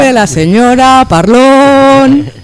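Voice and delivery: slow, drawn-out proclamation; town crier. Pretend. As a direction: announce it like a herald. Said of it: "¿Volverá el pregonero también?". (pregonero solemne y lento)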